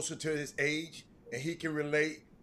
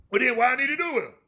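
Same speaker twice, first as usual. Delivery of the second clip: quick, high, louder comeback; retold objection; 6 semitones higher, 5 dB louder, much faster than usual. Retelling Penny's objection to a deal, quick high comeback. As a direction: fire back a higher-pitched question.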